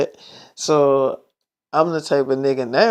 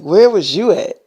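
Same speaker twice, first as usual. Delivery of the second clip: higher and louder demand; 5 semitones higher, 7 dB louder than usual. Retold or imitated. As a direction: snap the question at him. Jealous girlfriend confronts him.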